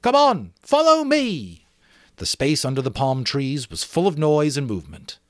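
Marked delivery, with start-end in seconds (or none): 0.0-1.7 high, louder slow call to the crowd; rallying call. Acted. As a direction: lead the charge. Jack leads boys away.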